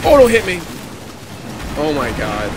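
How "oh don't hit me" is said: panicking gamer. Real, not acted. Enemy about to hit him.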